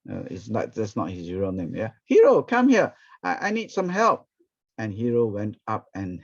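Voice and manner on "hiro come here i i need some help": high, loud call up from the roof. Retold or imitated. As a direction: shout the name across to him. Landlord calls from the roof.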